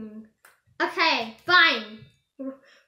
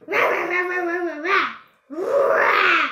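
shouted toy dinosaur rahs; dinosaur roar; 3 dB louder than usual. Pretend. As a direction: roar like a little T. rex.

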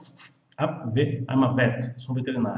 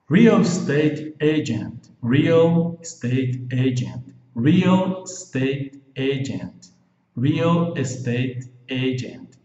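slow drill; slower than usual. Shown as a demonstration. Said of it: "slow drilling for students".

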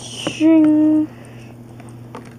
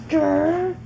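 ordinary talk

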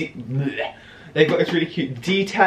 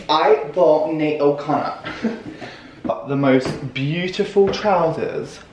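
ordinary delivery

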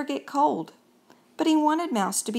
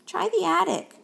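high ghostly voice; friendly ghost suggestion; 9 semitones higher than usual. Acted. Gus helping the fussy mouse find a room, high and helpful.

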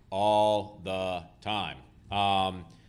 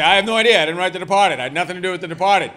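much higher and louder, fast at the end, insisting; exasperated shout; 9 semitones higher, 15 dB louder, faster than usual. Retold or imitated. Author shouting at the drunk in the bar, exasperated. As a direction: shout him off, you had nothing to do with it.

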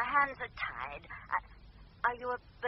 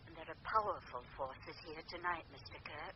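ominous hush; 7 semitones lower, 8 dB quieter than usual. Acted. Medium warns of forces.

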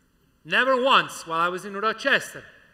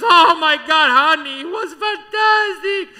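high and loud, rapturous; gushing mother; 5 semitones higher, 7 dB louder than usual. Retold or imitated. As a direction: swoon over the singer like a proud mama. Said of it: "She says".

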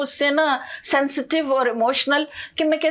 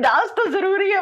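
laughing reply; 7 semitones higher than usual. Retold or imitated. Retelling her own reply, laughing.

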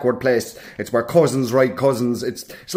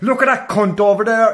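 put-on higher-pitched old granddad remark; cursing granddad; 6 semitones higher than usual. Granddad pointing someone out, mock old man's voice. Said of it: "He'd be like".

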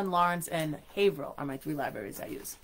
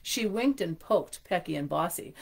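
ordinary delivery

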